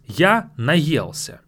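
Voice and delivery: slow, careful Я наелся; slow model pronunciation; slow teaching model. Shown as a demonstration. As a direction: spell out Я наелся slowly for learners. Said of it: "teaching phrase slowly".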